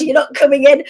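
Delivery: defiant shout. Retold or imitated. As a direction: shout them away from the door. Barring the doors against newcomers.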